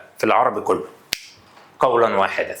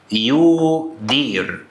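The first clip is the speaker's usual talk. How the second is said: slow demo word; much slower than usual. Shown as a demonstration. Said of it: "يدير يعني إيه؟".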